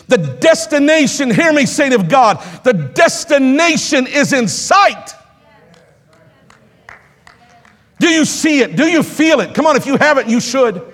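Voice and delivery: high, driving sermon delivery; rousing pulpit exhortation; impassioned preaching. Real, not acted. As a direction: preach it hard, pressing the congregation. Preaches at full force.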